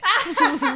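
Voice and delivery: high-pitched burst of laughter; high giggle. Real, not acted. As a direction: let the laugh squeak out. Laughing about accents.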